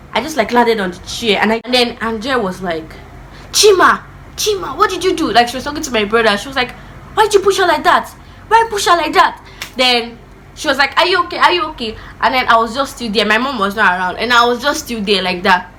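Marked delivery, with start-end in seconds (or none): 3.5-5.3 alarmed sister. Retold or imitated. 7.1-9.4 much higher, louder shocked scolding; scolding sister. Retold or imitated. 11.0-12.0 higher, concerned checking in; worried sister. Retold or imitated. Sister checking on hurt child.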